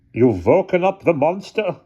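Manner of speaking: high, loud-ish accusing guard; high-pitched accusation with little accent; guard's voice, first take. A narrator's reading. Guard accuses Hannah of waking the monster, high voice. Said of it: "he says this in a really funny accent".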